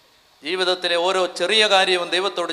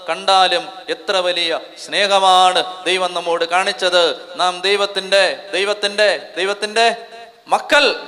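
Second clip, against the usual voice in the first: preaching scripture; 5 dB louder, slower than usual. Acted. Preacher proclaims the verse to the congregation, slow and building. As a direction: proclaim the verse, rising to the climax.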